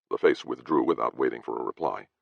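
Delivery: ordinary delivery, nothing marked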